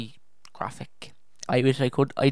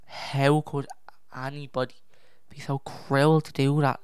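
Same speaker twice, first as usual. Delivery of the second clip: ordinary talk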